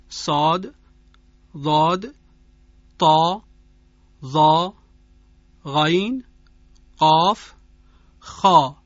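each letter, صاد ضاد طا ظا غین قاف خا, said slowly one by one with full heavy emphasis; heavy letters listed. Shown as a demonstration. (حروف مستعلیه با تأکید و درشت)

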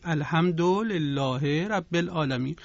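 plain, running Persian-accented reading; everyday Persian pronunciation of the Arabic. Shown as a demonstration. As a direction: say the Arabic as a Persian speaker would, unstretched.

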